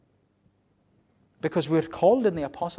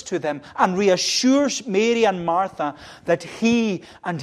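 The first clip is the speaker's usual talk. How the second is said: loud, driving sermon delivery, louder throughout; preaching at full force; 4 semitones higher, 9 dB louder than usual. Acted. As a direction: preach it out to the congregation.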